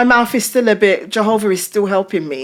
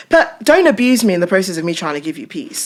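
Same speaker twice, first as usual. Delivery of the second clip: ordinary talk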